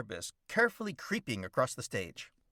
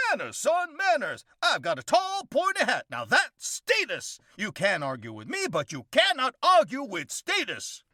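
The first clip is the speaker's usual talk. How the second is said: high, loud, pompous drawling windbag; blustery Southern gentleman; 12 semitones higher, 4 dB louder than usual. Acted. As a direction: lecture the boy like a self-important old colonel. Elrod boasts of status.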